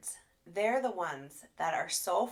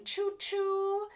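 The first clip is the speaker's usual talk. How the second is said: "choo choo" much higher, sing-song like a toy train; 12 semitones higher than usual. Shown as a demonstration. Therapist modelling train sounds for children. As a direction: call it like a child's train whistle. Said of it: "A train".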